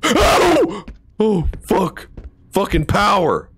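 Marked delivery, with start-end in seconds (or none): 0.0-0.8 real high startled cry; sudden high-pitched scream of fright; startled yelp. Real, not acted. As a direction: yelp out as if jumped. Jump scare in horror game.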